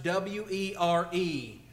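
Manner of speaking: "W E R E" slowly, letter by letter; slow spelling of the word "were" for the congregation; spelled out. Shown as a demonstration. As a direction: spell out "W E R E" deliberately. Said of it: "underline the word were".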